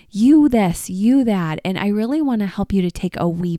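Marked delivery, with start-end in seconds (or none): 0.1-1.6 loud accusing outburst; angry quarrel. Retold or imitated. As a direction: throw it at them in a fight. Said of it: "at each other's throats saying".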